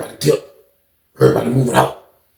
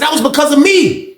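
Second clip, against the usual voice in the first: retold insistence; 7 semitones higher than usual. Acted quarrel, claiming credit.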